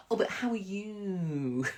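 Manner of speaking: slow, drawn-out gushing presenter voice; syrupy daytime-telly sweetness; Holly impression. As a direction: coo it slowly like a TV host. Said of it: "give us a Holly impression".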